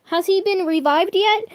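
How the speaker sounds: high, loud and thrilled; ecstatic. Acted. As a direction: ask it bursting with joy. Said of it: "Paper asked ecstatically".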